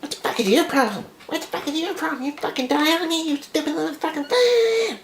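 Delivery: very high screechy mock-wife voice; yapping wife. Wife yapping at collapsing husband, imitated falsetto screeching. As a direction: screech it in shrill falsetto, right in his face. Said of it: "just fucking yapping".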